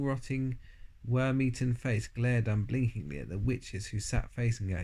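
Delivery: plain reading voice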